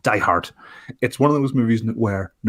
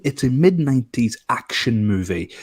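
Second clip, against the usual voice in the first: ordinary talk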